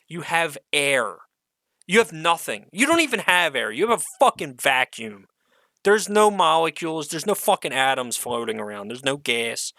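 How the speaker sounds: ordinary delivery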